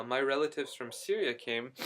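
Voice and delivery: normal talking voice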